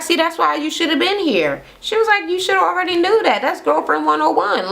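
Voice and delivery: high, fast lecturing; high mock-preachy scolding; high scolding know-it-all. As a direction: school her in a high voice.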